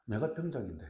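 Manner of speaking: usual speaking voice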